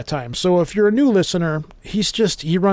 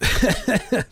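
short laugh; 6 semitones higher than usual. Real, not acted. Laughing at the show's name.

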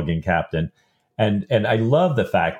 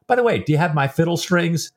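higher-pitched, breezy change of subject; mock-casual question, pitched up; oblivious denial; 7 semitones higher than usual. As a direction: imitate someone blithely in denial. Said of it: "in the absolute highest realms of denial".

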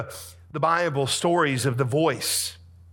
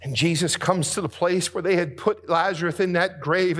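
usual speaking voice

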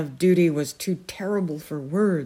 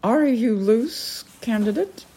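haughty falcon lady; 6 semitones higher than usual. Acted. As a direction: quiz him like a stern noblewoman. The Peregrine speaks.

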